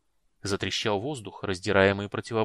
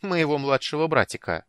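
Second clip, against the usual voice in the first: higher, louder playful teasing; 6 semitones higher, 5 dB louder than usual. Acted. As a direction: tease the little brother proudly. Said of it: "не устояв перед искушением немного поддразнить брата".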